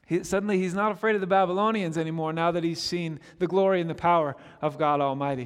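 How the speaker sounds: ordinary talk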